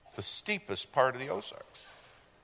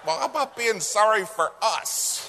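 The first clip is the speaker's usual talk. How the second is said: indignant retort; 10 semitones higher, 7 dB louder than usual. Retold or imitated. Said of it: "I'm like".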